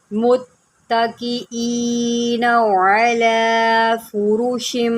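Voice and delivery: slow, drawn-out tajweed chanting; measured melodic Quranic recitation. Shown as a demonstration. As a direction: recite slowly, stretching each vowel. Recitation of verse.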